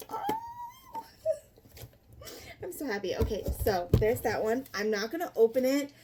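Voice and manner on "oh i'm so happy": laughing through near tears, high and soft; teary happy laugh. Real, not acted. Vlogger overcome unboxing her dream books, teary laugh. Overwhelmed by new book set.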